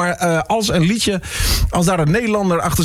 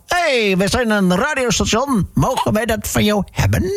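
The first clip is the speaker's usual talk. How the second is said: high, slow, drawn-out mock call; pretend phone pitch; 8 semitones higher than usual. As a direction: put on a high, chirpy caller voice. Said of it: "dan bellen we gewoon van".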